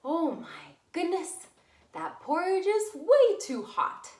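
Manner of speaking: loud, high, outraged bear voice; a big bear's loud cry about hot porridge; Papa Bear voice. Acted. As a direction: boom it out like a shocked papa bear.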